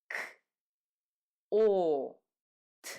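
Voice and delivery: "K", "O", "T" as separate sounds, long O; sounding out letters. Shown as a demonstration. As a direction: sound out "K", "O", "T" one by one with pauses. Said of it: "name the sounds that these letters".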